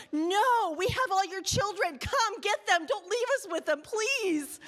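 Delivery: high, desperate mock plea to parents; a retold frantic plea from backstage; pleading volunteers. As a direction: beg the parents to come, hammed-up. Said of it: "me and all the volunteers are backstage going".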